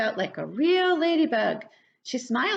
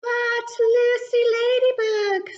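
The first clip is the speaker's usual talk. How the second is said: high, louder kindly caterpillar; 13 semitones higher, 5 dB louder than usual. Acted. Carla Caterpillar answering Lucy, high and kindly. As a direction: sing out her name in a high voice.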